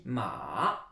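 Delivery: "ma" drawn out, dipping low and rising; Chinese word for horse. Shown as a demonstration. Teacher models the word for horse, a long dipping tone. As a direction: let "ma" sink low, then lift it. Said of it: "Le mot cheval en chinois se dit".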